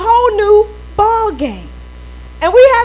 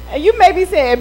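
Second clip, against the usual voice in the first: usual speaking voice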